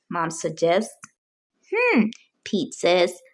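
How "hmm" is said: high musing hmm; a pondering hum up high; thinking hum. A narrator's reading. Pete thinking it over.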